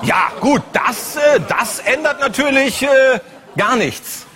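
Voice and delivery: sarcastic outrage. Acted. Mock outraged reaction to police.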